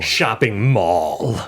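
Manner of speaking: "Shopping mall" drawn out slowly, rising; slow savoring. Shown as a demonstration. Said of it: "M A U L".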